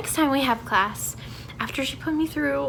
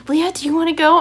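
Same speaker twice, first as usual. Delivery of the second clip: louder, higher mock-teacher invitation; imitating eager teacher; 5 semitones higher, 8 dB louder, faster than usual. Teacher turning to a student to go next, louder imitated invitation. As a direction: chirp it loudly at the chosen student. Teacher turns to her, retold.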